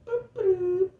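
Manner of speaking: high, squeaky boop boop; cute little sound effect; high playful boops. Real, not acted.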